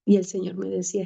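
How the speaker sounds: ordinary talk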